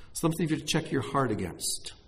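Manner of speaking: normal talking voice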